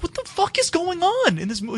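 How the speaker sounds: can't believe what the movie is doing; high incredulous rant; exasperated disbelief. Retold or imitated. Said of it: "And I'm just like".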